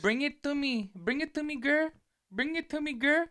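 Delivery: very high-pitched little girl impression; Shadi Bay. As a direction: mimic an internet girl's catchphrase in a high squeal. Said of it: "You don't know Shadi Bay?".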